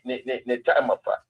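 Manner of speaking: ordinary talk, nothing marked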